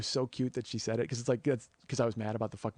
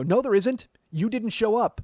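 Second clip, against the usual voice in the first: high, loud, angry contradiction; retold argument at full volume; indignant retort; 10 semitones higher, 9 dB louder, slower than usual. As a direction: shoot down the claim, outraged. Arguing with company over fee.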